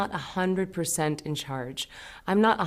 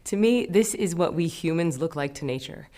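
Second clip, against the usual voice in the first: ordinary delivery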